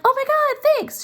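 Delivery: very high, delighted squeal; gushing thanks. Retold or imitated. Grateful wife receiving the invitation, squealing with delight. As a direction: gush like a thrilled wife.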